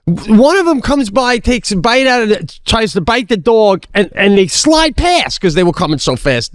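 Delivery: ordinary talk, nothing marked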